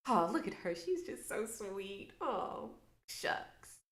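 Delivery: soft, breathy-sweet little voice, nearly whispered on shucks; mock sweet girl. Mimicking how colonizers see her, soft sweet voice. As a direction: play the harmless sweet girl, softly. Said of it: "It makes you feel like I'm just".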